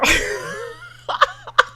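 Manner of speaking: high burst of laughter. Real, not acted. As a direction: crack up at yourself. Cracks up after voice.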